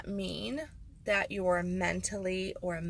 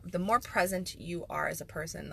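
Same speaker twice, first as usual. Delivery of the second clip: ordinary talk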